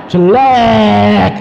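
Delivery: loud, drawn-out exclamation; mock disgust. Showing how the flowers' backs look, loud mock disgust. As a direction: stretch it out high and loud. Acted mock reaction to ugly back.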